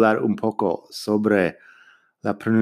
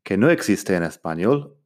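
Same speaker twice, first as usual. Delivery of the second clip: normal talking voice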